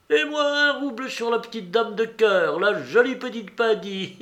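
high, playful voice ending in a giggle; high-pitched, tittering little gambler; giggling character. A narrator's reading. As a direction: coo over a tiny bet, then titter. Giggling Maximov bets on a card.

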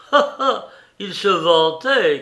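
loud, high scoffing "ha, ha"; jeering exclamation; mocking cry. A narrator's reading. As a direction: crow it out derisively. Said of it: "cria Rakitin".

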